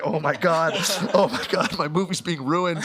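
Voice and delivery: mock despair, laughing. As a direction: ham up panic over the ruined shoot. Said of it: "I'm like".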